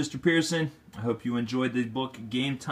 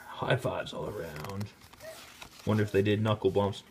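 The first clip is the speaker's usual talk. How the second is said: ordinary delivery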